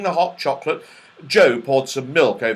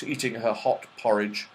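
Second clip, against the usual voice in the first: usual speaking voice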